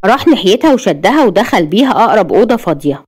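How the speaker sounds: usual speaking voice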